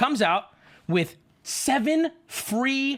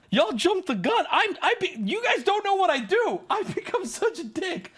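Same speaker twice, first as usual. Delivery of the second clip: high, worked-up and breaking into laughter; laughing protest; 10 semitones higher than usual. Real, not acted. Storyteller admitting he becomes the jerk, laughing protest. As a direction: protest in a high pitch till you crack up.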